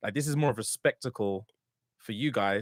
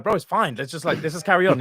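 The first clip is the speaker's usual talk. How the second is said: mildly exasperated, higher and louder; exasperated reassurance; 5 semitones higher, 5 dB louder than usual. Retold or imitated. Telling his worried friend to carry on, exasperated. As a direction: brush off a fussing friend, raised voice.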